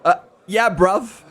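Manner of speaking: a light, laughing Cockney "yeah, bruv"; mock Cockney. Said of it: "are you Cockney?".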